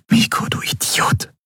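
hushed, voiceless whisper. A narrator's reading. Endriel kneels beside lifeless Miko, whispering. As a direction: whisper it under your breath. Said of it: "flüsterte sie".

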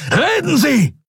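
very high-pitched, explosive command; short burst of yelled anger; furious bellow. A narrator's reading. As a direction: roar it at the prisoner. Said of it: "brüllte Telios".

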